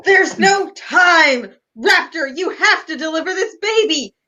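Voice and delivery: hammed-up panic; urgent plea. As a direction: beg for help as if every second counts. Wife urges raptor to deliver.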